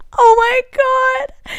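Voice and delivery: slow, high, loud disbelief; drawn-out oh my God; stunned. Real, not acted. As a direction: draw out the shock. Reacting to diary cringe.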